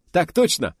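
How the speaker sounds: high, loud clipped bark; barked yes sir. Acted. Eager officer answering the admiral, loud bark. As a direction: fire it off like on parade. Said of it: "рявкнул Киврин".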